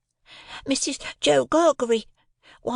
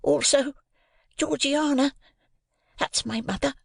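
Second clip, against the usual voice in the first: timid explaining; 12 semitones higher than usual. A narrator's reading. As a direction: explain meekly, like a scared child. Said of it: "I timidly explained".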